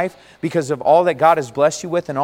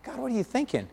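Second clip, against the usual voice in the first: soft baffled asking of God; hushed puzzled plea; 10 dB quieter than usual. Retold or imitated. Believer quietly asking God what he's doing. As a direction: murmur it, quietly bewildered. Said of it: "you're like".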